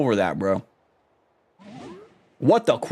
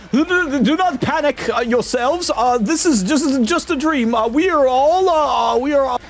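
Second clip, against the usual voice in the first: much higher, hammed-up frantic merchant; panicked merchant voice; 11 semitones higher than usual. Merchant panicking at pirate goats, high squeal. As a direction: squeal it in mock terror. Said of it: "I gotta get a voice for this".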